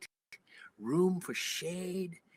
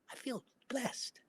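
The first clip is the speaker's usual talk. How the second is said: awed whisper; 8 semitones higher, 7 dB quieter, slower than usual. Real, not acted. Awed at the ancient find.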